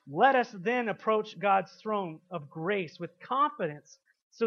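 loud, rising sermon delivery, words pushed out to the hall; preaching at full force. Real, not acted. Preaching the Hebrews passage loudly.

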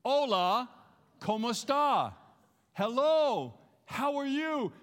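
Spanish greeting called out to the congregation; leading the greeting. Shown as a demonstration. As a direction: call it out for the crowd to repeat. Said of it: "Say that with me".

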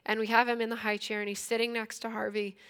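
ordinary delivery

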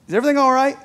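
king checking on someone he doubts, lightly acted; suspicious king. King to his wine taster, suspicious. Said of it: "The king sees something suspicious going on".

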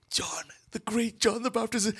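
soft, high awed whisper. Acted. Peter greeting John's disciples, awed whisper. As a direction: whisper it in awe.

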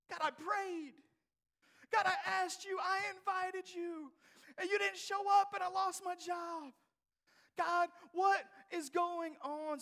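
high, loud, near tears; anguished crying prayer. Acted. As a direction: cry out to God in hurt. Acted prayer of complaint to God.

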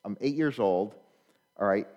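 ordinary talk, nothing marked

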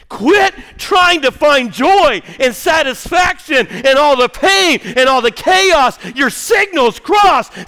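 loud, slow, high fervent preaching; preaching at full force. Acted. Preacher urging the congregation off their problems, loud fervent preaching. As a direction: drive the message home, slowly.

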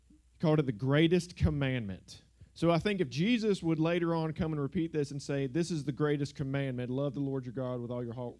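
normal talking voice

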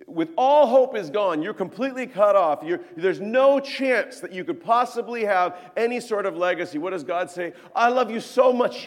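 loud, high, full-force preaching; impassioned preaching. Acted. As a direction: build to a loud, fervent declaration of love.

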